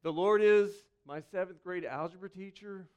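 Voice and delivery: a mock misquote of the psalm in an everyday tone; a pastor jokes with the congregation, plain mock misquote; joking wrong version. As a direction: toss off the wrong line as a joke. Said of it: "It doesn't start".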